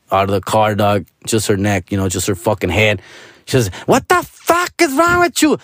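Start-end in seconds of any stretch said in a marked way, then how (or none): 3.8-5.6 high-pitched indignant woman's yell. Retold or imitated. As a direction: imitate an angry woman yelling from a car.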